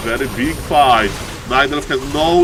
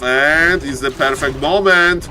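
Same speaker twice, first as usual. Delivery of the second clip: ordinary talk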